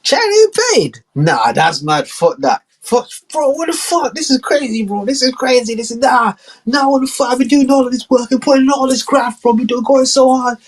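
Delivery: very high, whiny outrage; outraged Trump. Acted. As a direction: squeal like a wronged big shot. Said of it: "He's like".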